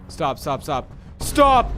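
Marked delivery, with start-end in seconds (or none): 1.2-1.7 high, loud plea; panicked cry. Real, not acted. Player fears Sonic is near, panicked cry. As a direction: cry out to halt it.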